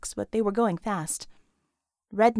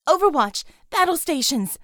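loud, high call to arms; urgent cry; 5 semitones higher, 7 dB louder than usual. A narrator's reading. Hero calling her team to battle stations, loud urgent cry. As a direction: shout the alarm as she tumbles in. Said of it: "she cried".

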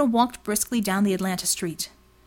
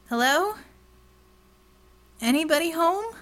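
higher call into the alley; pitched-up calling out; 7 semitones higher than usual. Acted. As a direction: call out to find him.

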